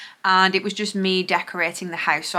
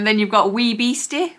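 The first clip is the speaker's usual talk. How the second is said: usual speaking voice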